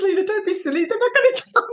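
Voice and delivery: high, giggling through the words; can barely get it out for laughing; quoting her friend while cracking up, pitch way up. Real, not acted.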